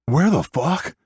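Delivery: put-on high, breaking boy's voice, confused and scared; cracking voice. A narrator's reading. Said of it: "My voice cracks as I look around".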